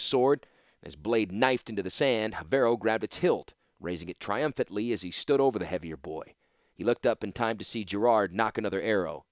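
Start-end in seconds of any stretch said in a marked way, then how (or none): none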